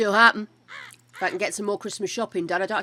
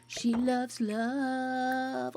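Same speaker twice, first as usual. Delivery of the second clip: very slow dreamy sing-song; 3 semitones higher, 4 dB quieter, much slower than usual. Pretend. Repeats it even more drawn out.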